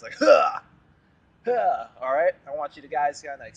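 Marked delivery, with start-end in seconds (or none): 0.2-1.9 powerful grunt. Shown as a demonstration. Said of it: "powerful stance".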